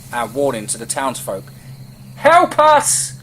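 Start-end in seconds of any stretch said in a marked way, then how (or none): 2.2-3.1 high, very loud cry for help; desperate shout. A narrator's reading. Moses pleading with townsfolk as wolves close in, desperate shout. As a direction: shout it out to rouse a whole town. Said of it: "Moses shouted".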